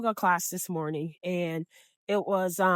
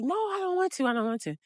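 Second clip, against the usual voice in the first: reluctant refusal; 8 semitones higher, 6 dB quieter, faster than usual. Retold or imitated. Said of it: "she's like".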